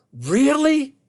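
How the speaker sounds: high, drawn-out disbelief; incredulous. Real, not acted. Speaker reacts to wife's retold insult, incredulous. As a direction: challenge it as plainly absurd.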